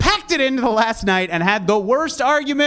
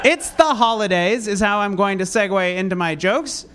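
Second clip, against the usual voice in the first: normal talking voice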